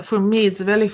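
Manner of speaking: ordinary delivery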